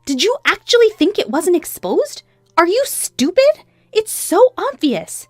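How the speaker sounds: high, loud, incredulous scolding; scornful angry wife. Acted. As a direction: throw the accusation at him, drawing out the insult. Wife confronts cheating husband.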